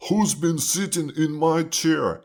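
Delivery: big father bear demanding an answer. A narrator's reading. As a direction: play an indignant father bear. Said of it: "he says".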